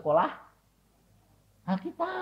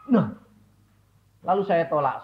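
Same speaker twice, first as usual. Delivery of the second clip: normal talking voice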